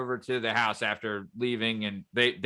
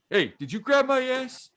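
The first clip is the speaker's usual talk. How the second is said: high-pitched movie catchphrase impression; put-on high character voice; big shot club owner; 12 semitones higher than usual. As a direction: imitate the club owner confronting someone. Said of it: "the big shot club owner guy".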